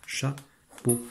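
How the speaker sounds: "Chapeau" as cha, pause, peau; split into syllables. Shown as a demonstration. Teacher showing which letters are read together, syllable by syllable. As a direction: leave a clear gap inside "Chapeau". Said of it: "on lit chat ensemble et peau".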